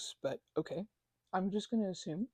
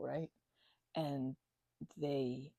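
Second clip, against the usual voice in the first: ordinary delivery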